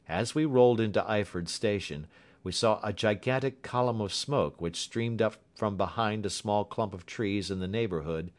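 ordinary delivery

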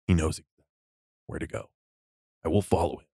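a hint of a solemn armored hunter; stoic Mandalorian. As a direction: deliver it hushed and deadpan, like a bounty hunter of few words. Friends quote the stoic Mandalorian.

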